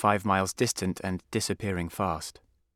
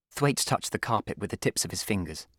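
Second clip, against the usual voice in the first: plain reading voice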